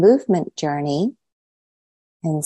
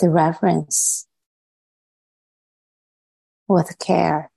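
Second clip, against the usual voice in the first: unhurried meditation guidance with long pauses between phrases; measured phrases set apart by spacious silences; guided meditation. Real, not acted. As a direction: lead them inward, leaving silence after each short phrase.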